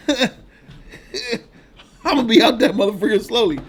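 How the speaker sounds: laughing mock farewell; mock goodbye to YouTube. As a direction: tease YouTube while cracking up. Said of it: "I'm like".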